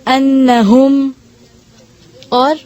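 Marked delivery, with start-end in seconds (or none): 0.0-1.1 انہم with the ن held in the nose; انہم with a slow ghunna; nasal hold on doubled n. Shown as a demonstration. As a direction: hold the ن of انہم slowly before the vowel. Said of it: "آواز کو تھوڑی دیر ٹھہراتے ہیں ناک میں".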